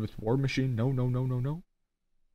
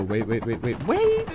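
squeaky panicked wait. Real, not acted. Sudden high pitched alarm.

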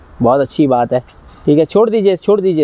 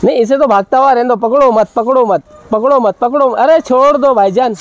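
higher-pitched, insistent plea; urgent calling out; 7 semitones higher than usual. Real, not acted. Host telling the handler to let the animal run, urgent calling out. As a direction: call out urgently to stop them holding on.

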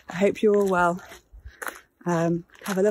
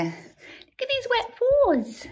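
much higher, very slow cooing; gushing pet voice; much slower than usual. Real, not acted. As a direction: melt over the little paws. Cooing to her wet dogs.

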